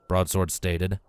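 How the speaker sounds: ordinary delivery, nothing marked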